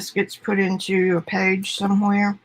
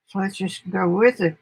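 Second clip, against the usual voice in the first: usual speaking voice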